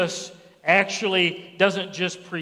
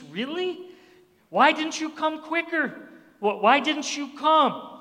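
high-pitched, pleading challenge to Jesus; questioning reproach; 8 semitones higher than usual. Acted. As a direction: plead upward, as if let down. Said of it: "her way of giving it to Je-, Jesus".

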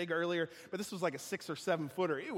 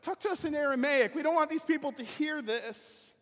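high, loud, hurried plea; alarmed court officials hushing the envoy; panicked officials; 9 semitones higher, 6 dB louder than usual. Retold or imitated. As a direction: beg them to switch languages fast. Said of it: "the recorder and the secretary are like".

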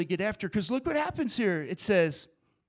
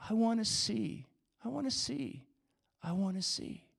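soft, repeated plea; hushed plea; 7 dB quieter than usual. Acted. Blind beggar answering Jesus, hushed plea. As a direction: plead quietly, softer on the repeats.

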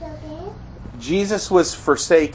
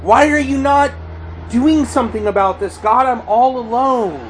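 high, loud, desperate demand; anguished cry; 12 semitones higher, 3 dB louder than usual. Acted. David all alone questioning God, anguished high cry. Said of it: "That's how David feels".

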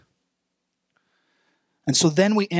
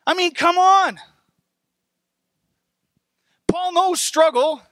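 high-pitched, incredulous preaching; worked-up amazement; 11 semitones higher than usual. Real, not acted. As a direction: exclaim it, pitch way up. Preacher marvels at Paul's struggles.

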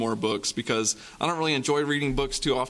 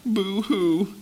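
mock boo-hoo; 7 semitones higher than usual. A narrator's reading. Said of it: "started to cry".

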